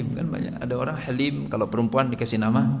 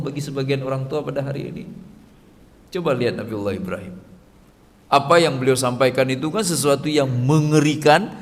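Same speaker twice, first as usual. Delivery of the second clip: ordinary talk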